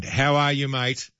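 usual speaking voice

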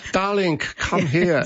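put-on slow, drawn-out Eastern European accent; lightly voiced Eastern European accent, slow darling. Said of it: "if you're doing an Eastern European accent".

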